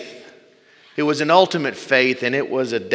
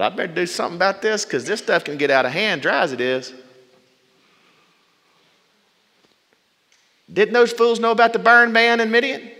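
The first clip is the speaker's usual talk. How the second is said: exasperated grumble; 9 semitones higher, 3 dB louder than usual. Retold or imitated. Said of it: "And Moses probably thought".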